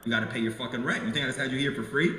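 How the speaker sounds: soft, quick mock reprimand; mock stern landlord. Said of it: "I'd be like".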